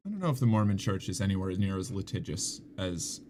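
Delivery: usual speaking voice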